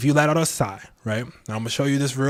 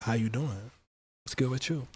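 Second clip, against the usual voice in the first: softer, put-on smooth greeting; mock smooth greeting; 7 dB quieter than usual. Host greeting viewers in a softer mock smooth voice. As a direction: lay on a smooth come-on.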